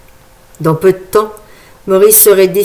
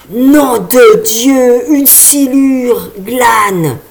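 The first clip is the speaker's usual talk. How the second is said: very drawn-out, high cry of amazement; slow, raised-pitch exclamation of shock; astonished exclamation; 4 semitones higher, 3 dB louder, much slower than usual. A narrator's reading. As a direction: cry out in awe, stretching every word. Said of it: "s'écria un autre homme".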